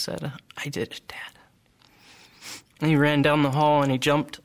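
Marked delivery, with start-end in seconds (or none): 0.6-1.4 happy whispered triumph; relieved whisper. Retold or imitated. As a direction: whisper it with a big smile. Big smile on his face.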